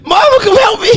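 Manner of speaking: loud high desperate cry for help; inmate yelling for mom. Pretend. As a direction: wail for mama from jail. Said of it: "For inmate".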